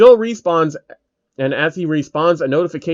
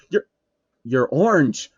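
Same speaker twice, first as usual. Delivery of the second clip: plain reading voice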